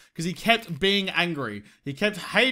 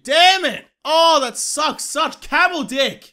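much higher and louder, stretched slow in disgust; exasperated shout; 9 semitones higher, 8 dB louder, much slower than usual. Real, not acted. Broke gamer shouting at his empty purse, exasperated shout. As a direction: bellow the complaint at the game.

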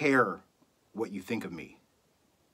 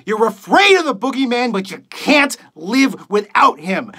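impassioned rant climbing in pitch; scornful tirade; 2 semitones higher than usual. Acted. Radio host berates millions of listeners for their fear, scornful tirade. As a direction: build from grim address to raised-voice outrage. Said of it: "reading a monologue for you guys".

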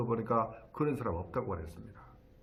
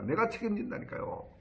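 higher-pitched exasperated insistence, without shouting; retold annoyance; 8 semitones higher than usual. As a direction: replay an annoyed insistence to the bank. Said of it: "화를 막 냈다". (높은 목소리로 짜증 내는 재연)

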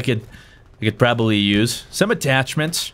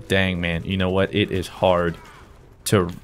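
ordinary talk, nothing marked

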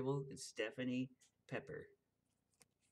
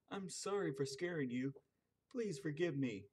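lightly voiced little man; 4 semitones higher than usual.

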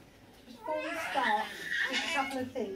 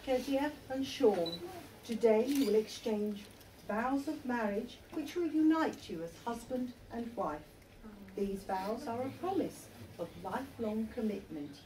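measured, solemn registrar's address; formal ceremony speech; 2 semitones lower, 4 dB quieter than usual. Acted. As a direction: officiate the wedding with dignity. Registrar conducts wedding ceremony.

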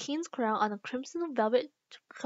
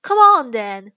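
roaring royal command; roared; 14 dB louder, slower than usual. A narrator's reading. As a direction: roar it out like an impatient queen. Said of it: "roared the queen".

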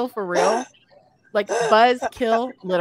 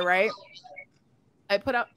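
normal talking voice

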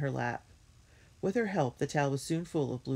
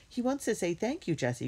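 lightly exclaimed, starting high; mild, high-pitched storybook exclamation; crying out; 8 semitones higher than usual. A narrator's reading. Violet speaks up for the grateful dog, crying out mildly. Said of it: "cried Violet".

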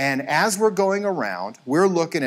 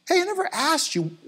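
much higher, breezy put-on voice; chatty fellow parent; 13 semitones higher than usual. Parent at the end banquet asks the coach, mock chatty. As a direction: imitate someone casually starting small talk. Acts parent casually asking his job.

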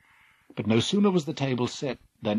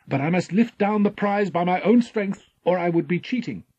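higher, louder noble declaration; proud hero; 9 semitones higher, 6 dB louder than usual. Acted. Jason refusing help, proud. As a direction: insist on honour.

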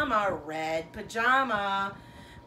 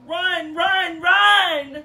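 urgent shout; 9 semitones higher, 15 dB louder, much slower than usual. Acted. Urging mama to hurry in story.

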